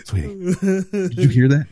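wife asking if he heard that, pitched high; wife's voice. Retold or imitated. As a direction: play the nervous wife calling out. Wife voice continues.

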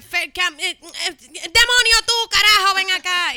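high, loud flustered mother stammering names; stumbling over names, then yelling at the kid to come here; mom's confused yell. Retold or imitated. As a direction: imitate a frazzled mom shouting for a kid. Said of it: "mami empezaba como que".

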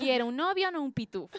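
loud joking demand; mock demand. Friend mocking a short-boyfriend complaint, loud and teasing. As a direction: blurt it out like a declaration. Mock quote about tall men.

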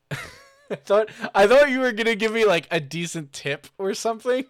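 laughing high and loud. Real, not acted. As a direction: laugh through it in disbelief. Laughing disbelief at non-answer.

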